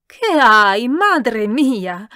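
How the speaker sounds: very loud, scolding outburst; exasperated shout. A narrator's reading. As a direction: burst out in loud reproach. Countess scolds too quiet reader.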